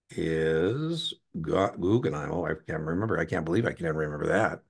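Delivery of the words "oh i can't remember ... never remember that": ordinary delivery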